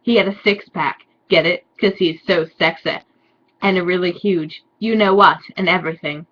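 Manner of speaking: usual speaking voice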